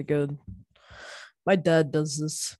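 ordinary talk, nothing marked

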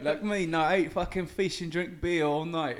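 lightly done cockney bloke, mate-style; put-on British accent. Guest on the show, answering in a light mock British accent. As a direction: play a chatty Brit. S0 plays British character Welvin.

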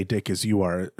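ordinary delivery

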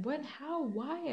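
baffled, high-pitched string of questions; retold shock. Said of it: "I was just so shocked".

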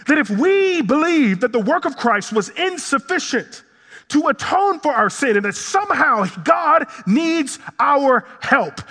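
high-pitched, driving sermon delivery; impassioned preaching. Acted. Preacher challenging the congregation's theology, impassioned. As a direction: preach it hard, punching key words.

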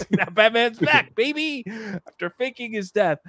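high, laughing excitement; excited. Real, not acted. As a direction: gush with delight. Excited about Batman's return.

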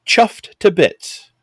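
slow, light attempt at English accent. Naming a British pale ale to co-host, light English accent. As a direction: lay a little English accent on it. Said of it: "had that enough English accent on that".

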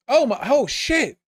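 real startled cry, high and loud; startled exclamation. Real, not acted. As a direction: jump high and loud, then stretch it out in shock. Sudden sight of onion domes.

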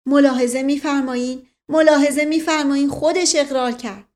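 loud, high, insistent appeal; triumphant shout. A narrator's reading. Dustali Khan appeals to Dear Uncle over the confession, shouting. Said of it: "رو به دایی جان فریاد زد".

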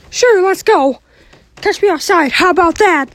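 heated family shouting. Acted. Mickey challenges Kermit.

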